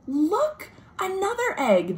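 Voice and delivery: put-on thrilled discovery for the kids; high excited find; excited gasp. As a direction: gasp and a high, bright look. Finding egg under flap.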